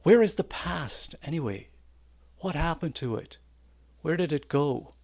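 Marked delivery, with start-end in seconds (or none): none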